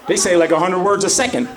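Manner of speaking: high, fast mock mama cussing out her kid; angry mom rant. A mom cussing out her kid, fast mock yelling rant.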